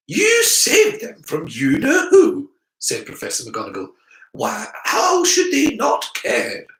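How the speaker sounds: high, loud, slow disbelief; incredulous professor. Acted. Professor challenging the boy's doubt, high and incredulous. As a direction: press the question like a strict witch.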